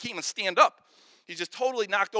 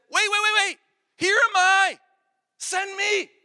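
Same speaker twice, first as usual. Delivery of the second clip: eager shout; 10 semitones higher, 9 dB louder than usual. Acted. Isaiah eagerly interrupts heaven's council.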